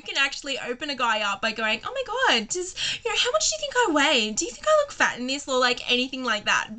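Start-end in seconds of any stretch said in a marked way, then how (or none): 1.8-5.5 high, gushing airhead voice; mock ditzy girl. Narrator mimicking her own ditzy pickup line, high mock gush. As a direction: play the clueless girl asking about her weight.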